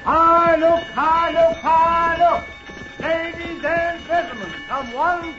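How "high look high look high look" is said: loud, high carnival barker call. Acted. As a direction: bark it out to draw a crowd. Barker calling the crowd.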